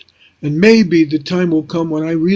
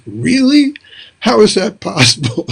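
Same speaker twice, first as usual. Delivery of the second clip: mock surprise; 4 semitones higher than usual. The teacher hears a former student's thanks, mock surprised. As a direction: jump high on Really, playfully stunned. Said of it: "You're like".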